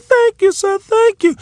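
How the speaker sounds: very high, squeaky grateful thanks; little man's thanks. Retold or imitated. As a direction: squeak out a tiny polite thank you. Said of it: "He said".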